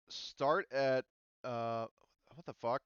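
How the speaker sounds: ordinary talk, nothing marked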